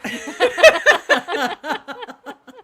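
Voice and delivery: loud, high peal of laughter; real, helpless laughing fit; burst of laughter. Real, not acted. Reacts to don't believe the hype.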